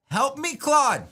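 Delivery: high mock-helpless old lady cry; playful old lady. Old lady begging her husband Claude to help, high mock plea. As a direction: play an old wife pleading for help. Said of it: "I'm some, like, old lady".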